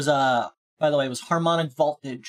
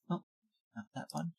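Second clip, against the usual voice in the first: ordinary delivery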